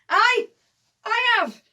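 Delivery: high, loud, delighted-to-answer cry; shout. Acted. Johnny answering the belt's question, high shout. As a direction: shout it out in two bursts. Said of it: "and then he shouts".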